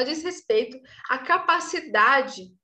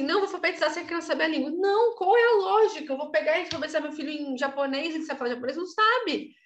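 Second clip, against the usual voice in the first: higher-pitched, incredulous argument; exasperated mock argument; 4 semitones higher than usual. Teacher mocking the idea of literacy without knowing the language, exasperated. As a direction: argue it out in a raised, indignant pitch. Acts out objection and exasperated retort.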